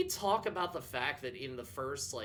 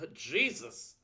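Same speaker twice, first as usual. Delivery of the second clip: normal talking voice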